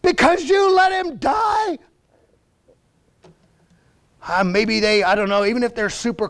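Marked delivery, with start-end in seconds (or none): 0.0-1.8 high, shouted, indignant challenge; heated, high-pitched outburst; angry accusation. Acted. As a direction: throw the blame at him, drawing out the last words. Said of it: "She's just angry".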